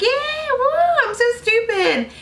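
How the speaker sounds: high squealing whoop of reckless fun; giddy squeal. Retold or imitated. Teen girls cheering donuts in a parking lot, giddy high squeal. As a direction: squeal it like teens thrilled at being stupid.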